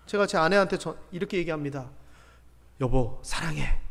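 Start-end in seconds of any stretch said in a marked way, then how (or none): 2.8-3.8 low, slow, softened, self-conscious declaration; awkward confession. Acted. Husband awkwardly telling his wife he loves her, low and awkward. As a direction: say it haltingly low, like someone unused to saying it. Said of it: "좀 어색하죠".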